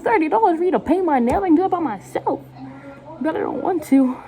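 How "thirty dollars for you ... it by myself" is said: high-pitched mock disbelief; incredulous retort. Customer answering a nail salon price, incredulous and high. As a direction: scoff at the price, voice way up.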